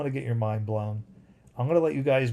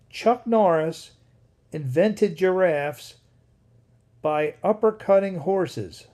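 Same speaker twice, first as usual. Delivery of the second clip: high, loud and slow, savouring each word; stunned, drawn-out reading of a crazy comment; amazed read-out; 5 semitones higher, 6 dB louder, slower than usual. Real, not acted. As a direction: read it out like you can't believe it. Reading a wild chat comment aloud.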